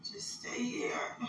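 slow, tearful whisper. Real, not acted. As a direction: plead softly through tears. Emotional plea to baby.

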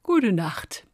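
good night through a yawn. A narrator's reading. Fox bedding down beside the snail, yawning good night. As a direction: say it while finishing a big yawn. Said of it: "und gähnte".

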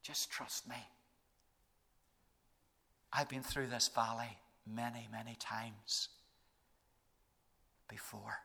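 hushed, low and gentle reassurance; soft whisper. Retold or imitated. Jesus reassuring the believer in the valley, hushed murmur. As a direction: murmur it close, like a secret comfort. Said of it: "what he whispers in our ear".

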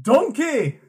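high-pitched mock-Scottish ogre call; Shrek. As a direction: call out in a put-on Scottish cartoon accent. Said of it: "trying to sound like Shrek".

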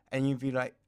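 usual speaking voice

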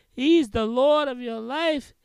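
ordinary talk, nothing marked

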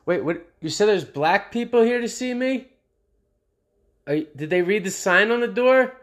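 high, loud, mock-outraged disbelief; mock shocked outrage. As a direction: play dumb in a squealing fake-shocked voice. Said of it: "my smart ass, like".

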